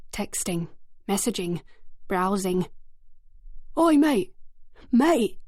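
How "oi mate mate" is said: loud, brash shout across the street; loud brash call. A narrator's reading. Thug calling to a boy on the street, loud and brash. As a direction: call out like a cocky lad. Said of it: "It was a loud, brash voice".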